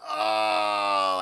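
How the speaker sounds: loud, low, drawn-out impressed "oh". Retold or imitated. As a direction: groan a long, low "oh" of mock delight. Character relishes the offer.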